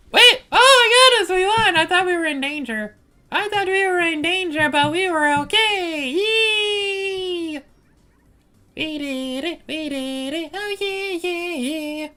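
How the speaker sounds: high-pitched shrieking victory cheer; shrieking jackpot winner. Real, not acted. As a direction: squeal with sudden real joy. Game win erupts mid-story.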